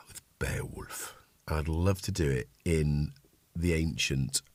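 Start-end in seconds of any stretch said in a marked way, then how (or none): 0.1-1.2 whispered. Real, not acted. Dreamy aside about Beowulf.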